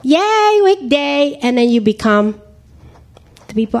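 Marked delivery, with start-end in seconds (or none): 0.0-1.4 mock cheer. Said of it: "you be like".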